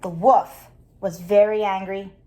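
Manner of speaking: ordinary delivery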